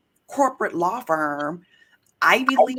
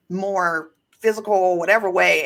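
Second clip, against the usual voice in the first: usual speaking voice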